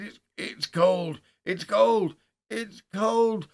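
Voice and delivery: loud, high, drawn-out cries; horrified cry. A narrator's reading. Man touching something cold in the dark, crying out in terror. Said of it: "like that three times".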